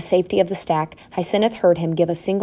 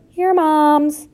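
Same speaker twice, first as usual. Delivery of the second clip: high, piping group reply; chorus of children; 10 semitones higher, 4 dB louder than usual. A narrator's reading. Young mice answer roll call together, piping. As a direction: pipe it up like a bunch of youngsters. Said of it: "there was a chorus of".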